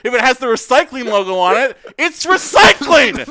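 high, loud indignant rant; worked-up, emphatic yelling; exasperated shouting. Retold or imitated. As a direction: yell it like scolding a roommate who never listens. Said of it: "you shouted at him".

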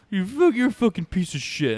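put-on high, loud drunk-friend tirade; imitated drunken abuse. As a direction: hurl insults like a belligerent drunk. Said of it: "he just gets drunk to the point".

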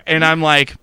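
normal talking voice

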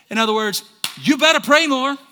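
loud, high, driving sermon; full-force preaching. Real, not acted. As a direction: pound out each phrase to the congregation.

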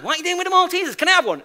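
put-on high, whiny child's pestering; pestering whiny nagging. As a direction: nag like a child wanting sweets. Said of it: "She must have asked me five times".

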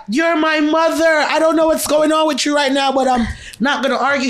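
mock son yelling. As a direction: play the tall son shouting her down. Son shouting back in argument.

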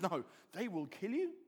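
calm, level correction; unalarmed, lifting at the end; Jesus. Acted. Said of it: "And Jesus says".

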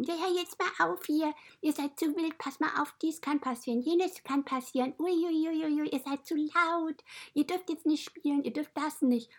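Shiba nagging scold. Retold or imitated. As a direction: scold the wild animals in a high, nagging voice. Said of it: "der schimpft immer alle, meckert immer rum".

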